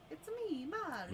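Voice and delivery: imitating a video game hero, cheerful and high; Mario impression. Wife mimics the game plumber to tease the streamer, high and bouncy. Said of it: "He did a good impersonation".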